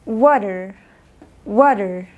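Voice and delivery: "water" with the T tapped like an R; American "water", wa-der with R at the end; American flapped T. Shown as a demonstration. Teacher showing American English to learners, flapped T in "water". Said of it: "en el inglés americano".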